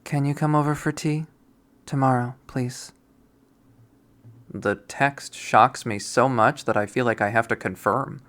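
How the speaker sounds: plain reading voice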